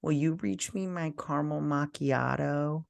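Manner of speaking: soft, drawn-up wheedle as the wife; wife's request. Retold or imitated. Girlfriend asking from bed for her coffee, softly imitated. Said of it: "she goes".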